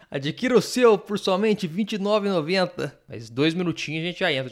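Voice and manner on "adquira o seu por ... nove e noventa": TV ad read. Pretend. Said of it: "vamos fazer uma propaganda aqui".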